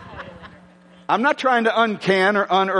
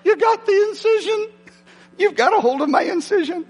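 much higher, strained yelp; mock pained cry; 12 semitones higher than usual. Preacher retelling a painful shoulder grab, high yelp. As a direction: squeal like someone grabbed your sore shoulder. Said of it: "It's like".

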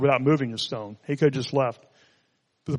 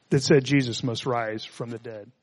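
usual speaking voice

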